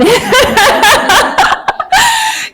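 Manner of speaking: laughing at herself; burst of laughter. Real, not acted. Laughs at her own accent slip.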